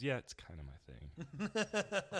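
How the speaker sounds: slow, smug, deadpan reply. Retold or imitated. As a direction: say it with total smug confidence.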